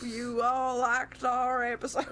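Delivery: slow, playful southern drawl; a light southern twang for the sign-off; southern accent. Pretend. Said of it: "I was about to do a southern accent".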